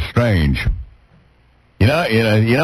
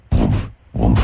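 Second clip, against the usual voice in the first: low mock woofs like a dog; dog barking; 11 semitones lower than usual. Host barking at his cohost like a dog. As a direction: bark it out like an eager hound.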